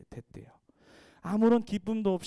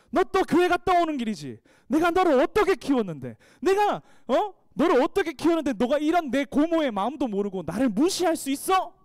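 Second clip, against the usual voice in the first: high, loud scolding aunt; aunt pouring out angry nagging at her niece; 8 semitones higher, 7 dB louder than usual. Retold or imitated. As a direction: hammer the niece with reproach, much higher and louder. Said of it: "이렇게 막 또 잔소리를 퍼부었습니다".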